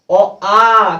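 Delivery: loudly called out vowels; teacher calls vowels out loud; loud recitation. Shown as a demonstration. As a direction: call out the vowels to the class. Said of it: "अब कैसे पढ़ते हैं?".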